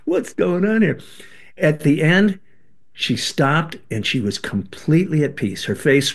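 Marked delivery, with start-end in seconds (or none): 0.0-1.0 shocked surprise. Retold or imitated. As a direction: blurt out in disbelief. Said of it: "we're all looking at each other".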